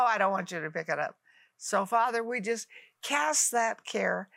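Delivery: ordinary talk, nothing marked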